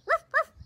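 high, loud playful puppy yips; puppy barking. Acted. Puppy showing the lamb his bark, playful yapping. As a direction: bark it like a cheerful little dog. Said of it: "I have a bark".

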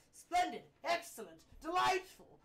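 loud, high theatrical exclamations; hammed-up booming sarcasm; mock delight. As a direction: burst out grandly, word by word. Andros rages sarcastically at another bill.

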